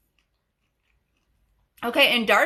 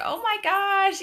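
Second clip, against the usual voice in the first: high-pitched mock amazement; amazed reaction; 9 semitones higher than usual. Wife realizing her husband is a professional, mock amazed. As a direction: voice jumps up high, acting out the realization. Acting out eye-opening reaction.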